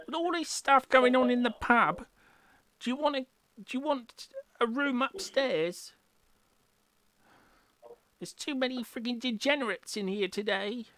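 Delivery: high, brash barmaid voice; loud sassy barmaid calls. Acted. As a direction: play the pushy barmaid. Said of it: "the barmaid comes up to you, says".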